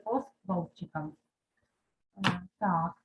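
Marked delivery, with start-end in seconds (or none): none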